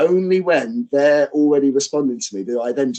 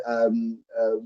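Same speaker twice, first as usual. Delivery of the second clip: usual speaking voice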